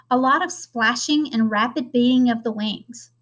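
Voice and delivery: ordinary delivery, nothing marked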